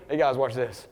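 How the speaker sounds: low, hushed sly aside; imitated under-the-breath tip-off; confiding aside. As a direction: let your friends in on it quietly. Jesus's aside to watching disciples.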